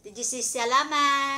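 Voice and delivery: loud, high, eager prompt. Real, not acted. Mother prompting child to say thanks.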